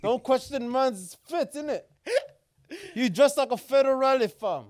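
lightly put-on London roadman accent, laid back. To co-host in the bow tie, a light London roadman accent. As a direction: talk like a roadman from the ends, a hint of London.

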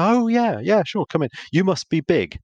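high, delighted dawning of recognition; sudden recognition. Retold or imitated. Householder recognising the cricket sponsor and inviting him in, delighted. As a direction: swoop up high as the penny drops, then welcome them.

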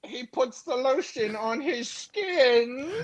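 high, slow creepy killer voice; eerie, drawn-out horror villain line; Buffalo Bill impression. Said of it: "doing that funny impression".

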